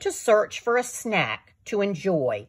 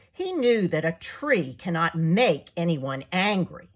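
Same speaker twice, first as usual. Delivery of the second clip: ordinary delivery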